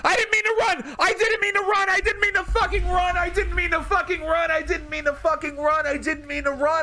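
high, panicked, repeated yelling; frantic wailing. Real, not acted. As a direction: wail it in disbelief at your own mistake. Accidentally ran from a Pokemon.

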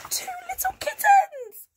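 very high, loud squeal of delight; excited reveal. Pretend. Storyteller revealing what the animals saw, excited squeal. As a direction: squeal the big surprise to children.

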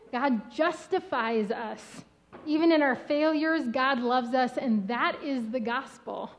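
usual speaking voice

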